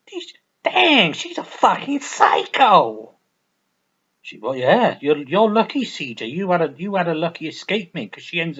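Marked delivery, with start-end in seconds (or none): 0.1-3.1 high, loud, slowed outburst; cracking exasperated yell. Retold or imitated. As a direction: shriek it in disbelief. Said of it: "And C J's like".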